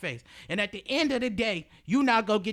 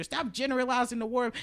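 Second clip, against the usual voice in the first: ordinary talk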